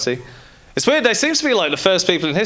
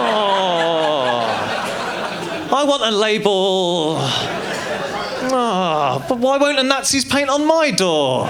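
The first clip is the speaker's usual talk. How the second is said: put-on high, drawn-out childlike wail; put-on pleading, pitched way up; mock pleading; 8 semitones higher, slower than usual. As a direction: beg like a naive child, hammed up. Neutral people pleading, childlike wishes.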